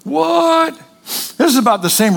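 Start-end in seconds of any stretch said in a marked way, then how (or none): 0.0-0.8 sudden jump up in disbelief; a startled double take; mock shock. Peter stunned by the vision, mock shock. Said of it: "Peter's all".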